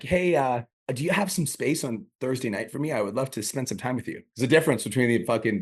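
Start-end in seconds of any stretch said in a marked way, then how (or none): 0.0-4.2 higher, hesitant, pleading ask; mock needy politeness; friend-zone guy. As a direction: imitate a timid suitor asking for a date. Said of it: "the guy that's in the friend zone".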